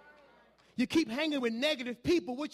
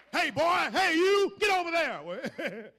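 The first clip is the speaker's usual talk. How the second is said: higher, louder bossy call, slowing on the last order; mock supervisor shout; 4 semitones higher, 5 dB louder, much slower than usual. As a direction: holler like a boss across the floor.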